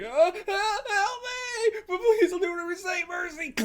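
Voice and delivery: high, panicked begging for mercy; fluttering cries for help. Acted. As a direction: squeal for help like a coward. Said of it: "I think this is gonna be Nishi".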